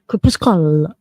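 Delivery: quick, firmer stab at Crepuscula. Shown as a demonstration. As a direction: say Crepuscula as one joined word. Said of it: "I'm sure it can be said faster".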